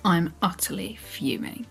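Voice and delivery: usual speaking voice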